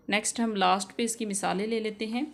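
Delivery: usual speaking voice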